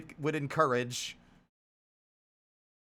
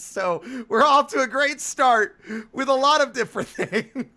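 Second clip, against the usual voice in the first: laughing; 8 semitones higher, 7 dB louder than usual. Real, not acted. Joking about writing pace.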